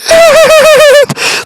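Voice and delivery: very high, loud hysterical laugh; wild disbelieving laughter; shrieking laugh. Real, not acted. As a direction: cackle in shock.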